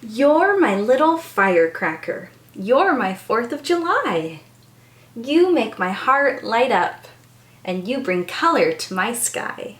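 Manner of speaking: gentle storybook reading; reading to children. Acted. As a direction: bedtime picture-book voice. Reads picture book aloud.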